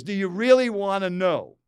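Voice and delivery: booming, exasperated retort; loud annoyed challenge. Retold or imitated. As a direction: throw the question out loud and irritated. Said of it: "In that kind of tone, not that loud".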